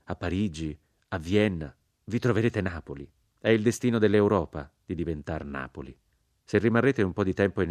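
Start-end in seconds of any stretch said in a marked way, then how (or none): none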